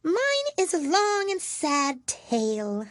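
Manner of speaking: high, slow, mournful mouse voice; squeaky creature sighing out its sorrow; tiny sad mouse lamenting. A narrator's reading.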